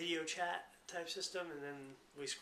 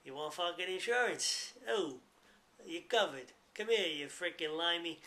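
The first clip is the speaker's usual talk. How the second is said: hammed-up Brooklyn Italian accent, louder and pushy; mock Brooklyn Italian accent; 3 semitones higher, 7 dB louder than usual. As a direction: play a pushy Brooklyn Italian insurance salesman. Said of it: "a Brooklyn Italian accent trying to sell you insurance".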